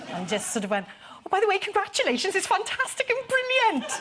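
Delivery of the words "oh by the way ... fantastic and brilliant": hint of a Welsh lilt, gushing high and fast; mock Welsh gush. Fawning over the tall leader in mock Welsh. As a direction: gush at him in a put-on Welsh voice. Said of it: "I became very Welsh when I met him".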